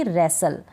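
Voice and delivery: "रेसल" with a silent T; correct pronunciation. Shown as a demonstration. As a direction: repeat "रेसल" without any T. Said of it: "क्या पढ़ूंगी".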